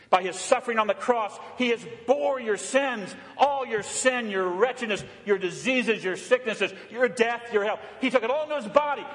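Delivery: rising to a shout, rushing at the end; fervent preaching. Acted. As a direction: thunder God's words to the sinners. Preacher voices God's message.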